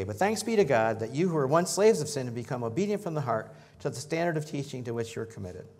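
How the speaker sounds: loud proclaiming read; scripture reading. Acted. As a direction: proclaim thanks to the congregation. Reads scripture aloud.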